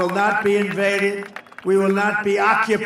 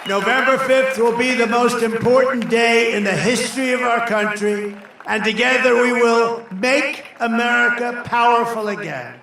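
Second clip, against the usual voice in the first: rally speech; 3 semitones higher than usual. Real, not acted. Building rally climax.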